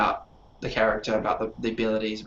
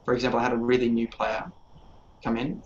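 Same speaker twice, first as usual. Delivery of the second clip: ordinary talk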